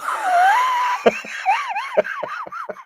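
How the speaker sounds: a burst of high, shrieking laughter; real laughter bursting out. Real, not acted. Big laugh at a chat comment.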